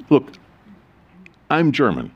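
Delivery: ordinary talk, nothing marked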